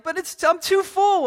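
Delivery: high-pitched mock kid protest; put-on child's complaint; overwhelmed child. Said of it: "they're completely overwhelmed".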